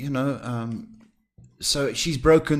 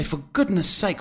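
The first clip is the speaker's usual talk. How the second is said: ordinary delivery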